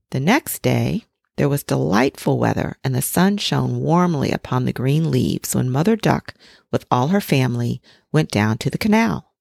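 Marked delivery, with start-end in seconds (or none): none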